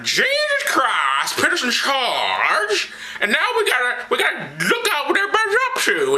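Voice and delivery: high, whiny scandalized preacher; high prissy Paul. Acted. Paul lectures Barnabas, shocked and whiny. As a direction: clutch your pearls and whine it high.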